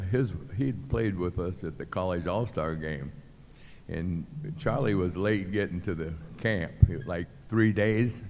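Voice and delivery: normal talking voice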